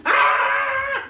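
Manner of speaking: a sudden high, loud wild scream. Retold or imitated. Swan women screaming as they dance, a high wild scream. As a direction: shriek it out like a woman gone mad. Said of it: "they were screaming".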